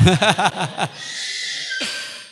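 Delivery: hearty laugh at oneself; long trailing laugh. Real, not acted. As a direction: laugh it out after a confession. Laughs at own confessed fear.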